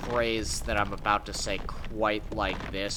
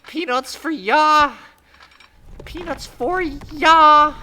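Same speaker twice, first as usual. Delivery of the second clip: very high, very loud cackling call; shrill flight attendant hawking peanuts down the aisle; squawking flight attendant; 16 dB louder, slower than usual. Retold or imitated. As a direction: belt out peanuts like a clucking chicken. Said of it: "she started going".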